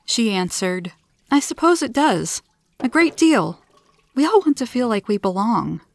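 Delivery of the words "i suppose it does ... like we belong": higher-pitched, lively admission; animated answer. A narrator's reading. Beth answers the alien about belonging, animated and higher. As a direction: lift the voice, mildly emphatic.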